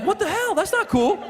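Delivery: very high, squeaky protest; wounded and offended, played for laughs; mock indignant. Hurt reply to an insult, retold.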